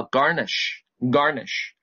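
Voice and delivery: garnish said very slowly twice for students to repeat; teacher modelling garnish slowly for students; model reading. Shown as a demonstration. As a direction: drag out garnish slowly so the class can follow. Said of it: "下面一个单词给我念".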